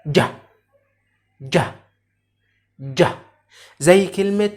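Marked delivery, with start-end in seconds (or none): 0.1-3.0 "ج" as a short English J, "jah"; letter sound demo. Shown as a demonstration. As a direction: sound out "ج" briefly as in jar. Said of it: "ونطق ال J". (نطق "ج" معطشة وقصيرة كصوت J)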